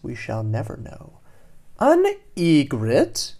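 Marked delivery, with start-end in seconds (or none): 1.8-3.3 slow, higher question with a big rise; curious fish asking. A narrator's reading. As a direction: draw it out and swoop up at the end. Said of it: "Doreen asks him".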